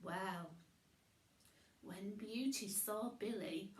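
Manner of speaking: usual speaking voice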